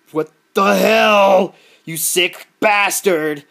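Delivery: hammed-up indignant threat; mock outrage. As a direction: play the gun nut losing it. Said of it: "gun nuts flip out".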